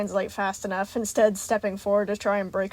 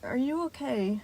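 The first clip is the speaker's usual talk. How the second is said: hesitant check-in with a pause after the name; slow, careful question; 3 semitones higher, slower than usual. A narrator's reading. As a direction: ask slowly and cautiously. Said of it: "Freddy asked slowly".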